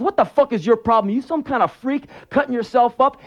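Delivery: high-pitched, incredulous angry boss. Retold or imitated. Boss confronting worker over his scars, acted angry outburst. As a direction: berate him like an outraged boss. Boss slams him against wall.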